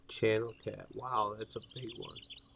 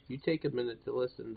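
ordinary delivery

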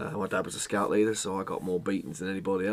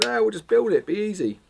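higher, louder carefree voice; higher, louder, falsely confident; mock breezy confidence; 10 semitones higher, 5 dB louder than usual. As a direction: toss it off like it's nothing. Retold own careless thought.

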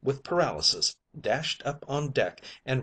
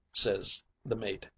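usual speaking voice

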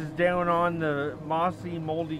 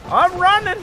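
panicked yelling; 13 semitones higher, 11 dB louder than usual. Real, not acted. Fleeing the animatronic.

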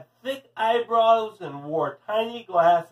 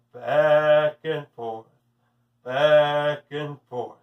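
drawn-out, lower hypnotic narration; slow swinging rhythm; 5 semitones lower, 4 dB louder, much slower than usual. A narrator's reading. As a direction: let each word swing like a pendulum. Mimicking the swinging pickle while narrating.

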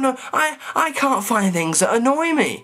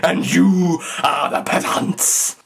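slow, hammed-up villain laugh then a grand boast; gloating balloon master; slower than usual. Gloating down from his balloon at the peasants, hammed-up slow mock villain. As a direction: lord it over the peasants below, slowly. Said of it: "and go".

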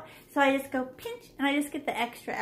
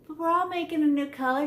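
ordinary talk

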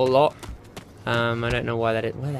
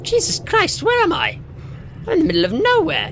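shocked outcry. Real, not acted. Player shocked at being lost, crying out high and fast.

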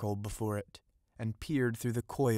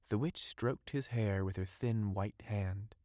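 ordinary delivery

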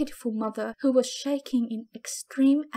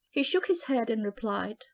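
ordinary delivery, nothing marked

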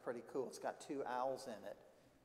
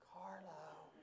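very soft, timid reply; whispered; 12 dB quieter than usual. Acted. Carlo answers the scary librarian, whispered. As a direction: barely let the name out. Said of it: "whispered Carlo".

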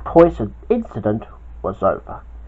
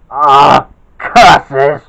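villain's moan; 9 dB louder than usual. Acted. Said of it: "moaned Rana".

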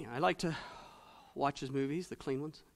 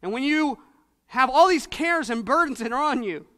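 higher and louder, impassioned sermon; fervent preaching; 8 semitones higher, 10 dB louder than usual. Acted. Preacher assuring new believers of God's care, impassioned preaching. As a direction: pour it out with fervour to the flock.

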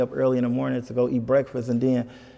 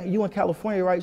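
higher-pitched motherly question; imitating mom; 6 semitones higher than usual. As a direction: lightly put on mom's higher voice. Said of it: "She was like".

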